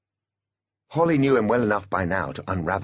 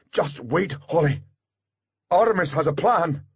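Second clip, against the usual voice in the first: higher-pitched urgent reassurance; bodyguard, urgent; 8 semitones higher than usual. A narrator's reading. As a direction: urge her to hold on. Voices Butler restraining Holly.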